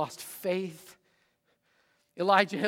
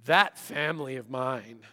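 lower, grumbling under the breath; muttered grumble; 7 semitones lower than usual. Retold or imitated. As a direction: mumble the complaint to yourself. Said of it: "Have you ever found yourself saying".

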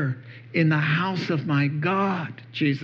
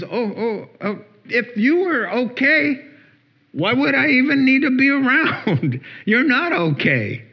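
lightly voiced God chuckling kindly; God's voice; 9 semitones higher than usual. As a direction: speak as a patient God who welcomes the broken. Voicing God speaking to us.

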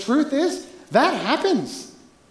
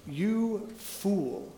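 God quietly rebuking the rich man, hushed and grave; solemn quiet rebuke; 2 semitones lower, 12 dB quieter than usual. Retold or imitated. To the congregation, quoting God's rebuke in a hushed voice. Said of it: "And God says to him".

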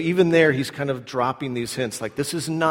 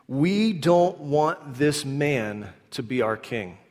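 slow solemn reading; much slower than usual. Shown as a demonstration. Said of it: "Look at what it says".

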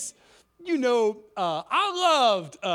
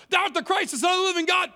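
high, emphatic burst of conviction; excited, higher-pitched proclamation; blurted declaration; 6 semitones higher, 3 dB louder, much faster than usual. Acted. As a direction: blurt it out loud and high, as Peter. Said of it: "It came out of him so fast".

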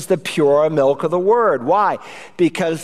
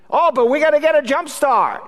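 high, insistent mock protest; hammed-up objection, pitched way up; whiny excuse; 10 semitones higher than usual. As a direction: plead the excuse in a put-on high voice. Acted excuse for skipping church.

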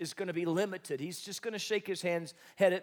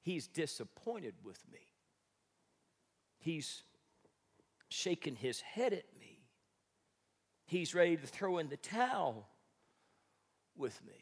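downcast inner voice. Retold or imitated. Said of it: "The thoughts I have is".

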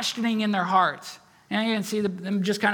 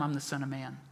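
quiet declaration; 7 semitones lower, 10 dB quieter, faster than usual. Retold or imitated. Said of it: "You wanna know who I am?".

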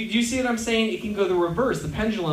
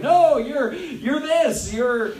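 mock insistence; 6 semitones higher, much slower than usual. Campus activists recruiting him to a party, mock insistence. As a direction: press the label on him, in mild imitation.

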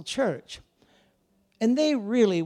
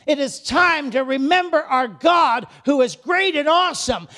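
shouted rally; 7 semitones higher, 8 dB louder than usual. Acted. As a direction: rouse them to fight. Nehemiah rallies, shouting.

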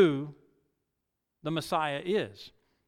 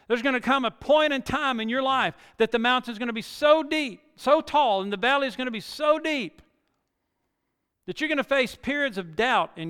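higher, louder preaching, rising to a peak on "so tall"; preaching at full force; 7 semitones higher, 4 dB louder than usual. Acted. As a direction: drive it home like a pulpit climax.

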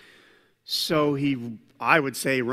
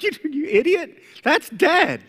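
much higher, scoffing voice; mocking naysayers; 12 semitones higher, 5 dB louder than usual. Naysayers mocking Jesus, high and scoffing. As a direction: sneer like the scoffers. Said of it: "They're like".

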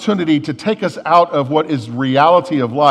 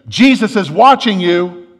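louder, higher mysterious call; mock scream; 5 semitones higher, 8 dB louder than usual. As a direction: call out an eerie warning in a joke. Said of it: "he hears this voice scream out".

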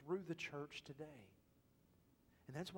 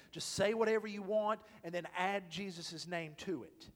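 loud, high, full-force preaching; fiery pulpit preaching; 7 semitones higher, 9 dB louder than usual. Acted. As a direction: lift the room, preach it out loud.

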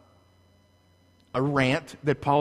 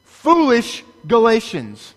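slow, louder, higher shout; screaming rebuke; 5 semitones higher, 7 dB louder, slower than usual. Acted. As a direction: bellow each word as a rebuke. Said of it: "here is Rick before you screaming".

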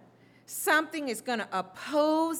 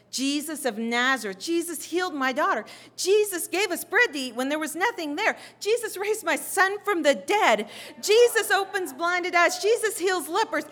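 building louder and higher into fervent testimony; excited testimonies; 6 semitones higher, 4 dB louder than usual. Acted. Townspeople spreading news of Jesus' miracles, with mounting excitement. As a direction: start at normal pitch, climb to a loud, high proclamation.

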